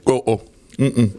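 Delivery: talking with cricket stuck in mouth; mouth full. Real, not acted. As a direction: wings stuck to the roof of mouth. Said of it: "the wings stuck to the roof of my mouth".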